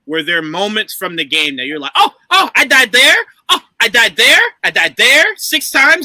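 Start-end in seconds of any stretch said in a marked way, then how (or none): 1.9-6.0 high mock excitement, rising at the ends; excited recognition. Host voicing a gamer recognizing old death spots, excited. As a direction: act out spotting it and pointing it out.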